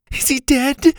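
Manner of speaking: high-pitched little question; worried girl. A narrator's reading. Girl checks on the unconscious teacher, worried.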